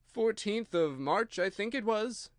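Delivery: a hint of a nervous hatter thinking; slow hesitant character voice. A narrator's reading. Hatter answers.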